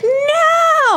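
high-pitched primal scream. Retold or imitated. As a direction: shriek it out, primal. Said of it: "primal scream of".

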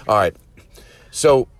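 ordinary talk, nothing marked

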